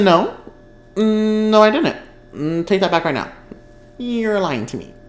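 high, loud playful protest; mock denial. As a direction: refuse to believe it, hammed up. Denying she got wrong side.

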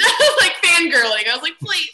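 high, loud squeal through laughter; giggly fangirling. Real, not acted. Contestant confessing her fangirl plea to the chef, giggly squeal. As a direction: laugh while squealing like an excited fan.

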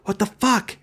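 acted road-rage outburst, pitched high; upset outburst. Driver to whoever cut them off, upset. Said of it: "you get upset with them".

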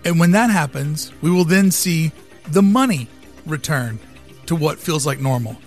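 dramatic speech. Acted. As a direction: deliver it like a keynote pitch. Dramatic visionary monologue over music.

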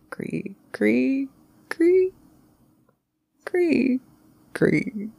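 high-pitched, spaced-out eerie creaks; imitated creaking. Back in bed hearing the stairs, eerie creaking. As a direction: squeak out each creak, leaving suspense between.

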